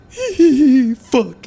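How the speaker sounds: high, loud cartoon yelp of a curse; cartoon sponge cursing. Streamer acting a cartoon sponge's curse, high yelp. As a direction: squeal it out like a startled cartoon kid. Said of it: "it was like".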